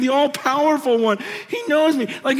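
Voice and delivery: normal talking voice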